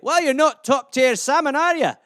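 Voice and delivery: hammed-up Scottish lilt; Scottish accent. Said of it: "some Scottish euphemisms for you".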